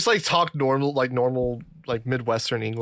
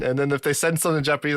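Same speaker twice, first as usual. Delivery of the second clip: ordinary talk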